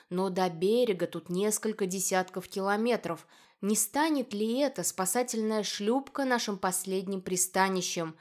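ordinary delivery, nothing marked

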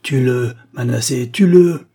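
put-on an old woman egging him on to kill, building to a shriek; sour urging. A narrator's reading. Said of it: "de sa voix aigre".

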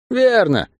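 high cry of agreement; mild cry. A narrator's reading. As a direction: call it out high, without real volume. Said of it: "крикнула Мари".

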